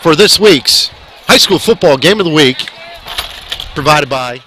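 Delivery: big, loud opening announcer voice; broadcast announcer. Acted. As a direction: boom out the welcome.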